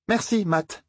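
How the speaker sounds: put-on dry, cutting sarcasm as the girl; sarcastic young woman. A narrator's reading. Egwene's sarcastic reply. (remerciement ironique et glacial)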